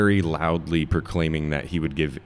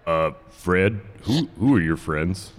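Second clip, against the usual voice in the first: second goblin; slower than usual. Acted. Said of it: "the other goblin wakes up and he's like".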